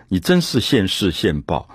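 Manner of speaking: normal talking voice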